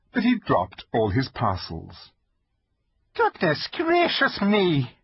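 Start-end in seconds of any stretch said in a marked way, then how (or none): 3.2-5.0 high, slow startled cry; startled exclamation. Acted. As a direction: gasp it out in surprise. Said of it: "exclaimed the fawn".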